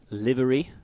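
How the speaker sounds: put-on British announcer accent; lightly posed British accent. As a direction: say it in your best British. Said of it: "my British accent".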